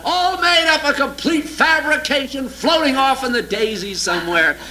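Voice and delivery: ordinary talk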